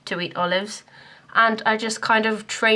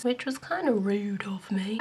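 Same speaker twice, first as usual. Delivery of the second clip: quiet, unvoiced whispered aside; hushed aside; 7 dB quieter than usual. Real, not acted. Whispering a cheeky aside to viewers. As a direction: drop to a secret whisper.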